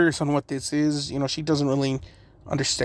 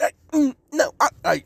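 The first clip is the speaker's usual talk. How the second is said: flustered stammer; 11 semitones higher than usual. Acted. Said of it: "Naruto's just like".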